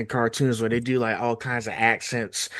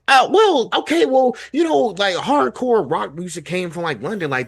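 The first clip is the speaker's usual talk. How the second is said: much higher, lightly voiced defensive rocker; high mock-sheepish explanation; sheepish cartoon singer; 7 semitones higher, 4 dB louder than usual. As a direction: fumble the excuse like a caught cartoon kid.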